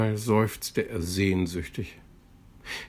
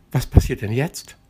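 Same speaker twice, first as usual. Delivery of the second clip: surprised; 4 semitones higher, 7 dB louder than usual. A narrator's reading. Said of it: "fragte Plitsch überrascht".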